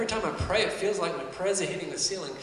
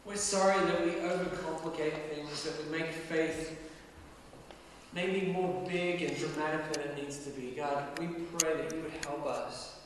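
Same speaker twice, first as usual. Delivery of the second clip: prayer. Real, not acted. I'm gonna pray for us.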